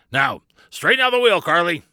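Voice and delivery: high, loud sailor's command; shouted order. A narrator's reading. Barnabas orders Carly at the wheel, shouting. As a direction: call it out firmly across the deck. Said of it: "Barnabas shouted".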